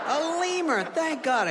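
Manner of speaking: high-pitched burst of relief; exaggerated relief. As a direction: seize on the news, pitch leaping up. Acted relief at hearing lemur.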